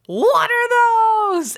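very high, drawn-out yell; shouted mock shock. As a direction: holler it slowly at the top of the voice. [name]'s shouted line, acted.

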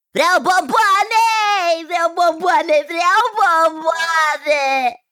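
put-on high-pitched wailing little boy; a child's drawn-out, high-pitched demand for sweets; screaming tantrum child. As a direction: whine it high, dragging out the last word. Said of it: "să țipe cât îl ținea gura".

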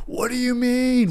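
high, drawn-out exasperated cry; imitated morning yelling. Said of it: "the most yelling".

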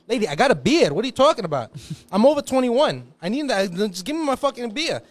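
high, loud exasperated demand; irate bar customer; indignant complaint. Retold or imitated. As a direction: argue with the cashier for beer. Drunk customer arguing for beer.

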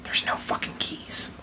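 whisper. Real, not acted. Whispered aside.

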